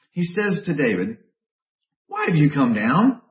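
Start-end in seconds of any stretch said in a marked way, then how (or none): 2.1-3.2 a mild mock rebuke from an older brother, louder toward the end; angry older brother. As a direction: scold the kid brother, half-acted. Preacher voicing angry older brother.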